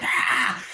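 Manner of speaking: voiceless mock hiss-scream; imitated monster rasp. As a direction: let out a voiceless ghoul cry. Imitates monster face in film.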